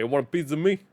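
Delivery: normal talking voice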